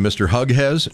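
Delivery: "Hughes" misread as "HUG-hez"; mispronounced name. Shown as a demonstration. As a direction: say "Hughes" as two hard syllables, HUG-hez, rising. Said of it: "they don't pronounce your name".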